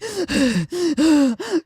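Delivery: gulping, sobbing gasps, acted out; imitated tearful hyperventilating; mock crying gasps. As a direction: gasp as if crying hard.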